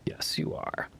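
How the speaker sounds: whispered dog praise; whispering to a dog. Real, not acted.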